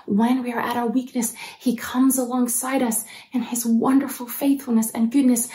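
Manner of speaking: ordinary talk, nothing marked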